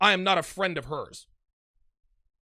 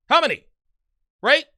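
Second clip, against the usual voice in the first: emphatic; 7 semitones higher, 6 dB louder than usual. Real, not acted. Emphatic rhetorical question to viewers.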